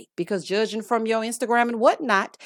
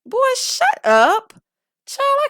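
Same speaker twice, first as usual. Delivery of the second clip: mock diva snap; 4 dB louder, slower than usual. Snaps back at own song.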